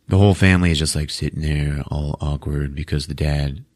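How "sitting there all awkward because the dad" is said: normal talking voice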